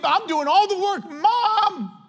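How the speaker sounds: put-on high whiny child complaining; high whining kid to mom. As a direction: whine like a kid stuck with chores.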